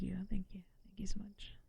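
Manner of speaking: soft breathy whisper of thanks; whispering gratitude to chat. Real, not acted. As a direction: drop to a hushed whisper.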